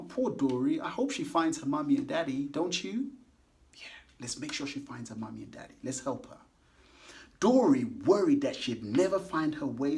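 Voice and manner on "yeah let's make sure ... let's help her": whisper. Real, not acted. Hushed aside to children.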